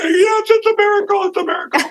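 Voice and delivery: overjoyed near tears. Retold or imitated. Answering the flight attendant, high tearful joy. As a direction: squeak it out, overwhelmed with wonder. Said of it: "and I'm almost in tears".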